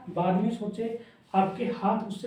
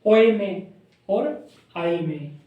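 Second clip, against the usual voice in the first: slow contrast; 4 dB louder, much slower than usual. Shown as a demonstration. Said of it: "ये फर्क पता लग गया दोनों में".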